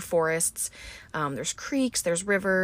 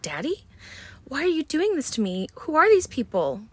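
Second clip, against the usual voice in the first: high, pleading daughter's voice; frightened daughter; 9 semitones higher than usual. Retold or imitated. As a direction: plead with her father, pitched way up. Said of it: "she says".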